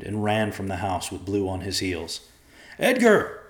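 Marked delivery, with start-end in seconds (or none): 2.8-3.4 loud, high urgent call; shouting after someone. A narrator's reading. Joe calls after the fleeing boy, shouting. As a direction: holler the name after a runaway. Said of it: "Joe shouted after him".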